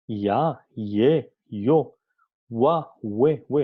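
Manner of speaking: ya, ye, yo, wa, we said one by one, crisp; teacher reciting sound combinations; listing diphthongs. Shown as a demonstration. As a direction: tick off each rising diphthong clearly. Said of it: "las combinaciones siguientes".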